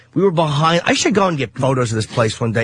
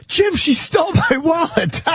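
whiny boss; 13 semitones higher, slower than usual. Retold or imitated. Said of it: "He goes".

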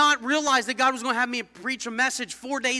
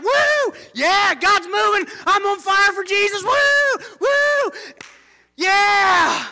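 high, loud whooping praise; on fire; 10 semitones higher, 7 dB louder, slower than usual. Retold or imitated. Believers cheering when God moves, high loud whoops. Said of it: "we're like".

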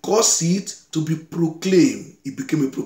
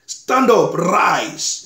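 raised, high-pitched summons; commanding call; 4 semitones higher, 4 dB louder, much slower than usual. Acted. Preacher voicing God's second call to Jonah, commanding. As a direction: call them to their feet.

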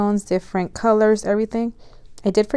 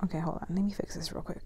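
much softer whispered aside; hushed aside, much softer; 3 semitones lower, 12 dB quieter, faster than usual. Real, not acted. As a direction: murmur it under your breath while fixing. Adjusting camera quietly.

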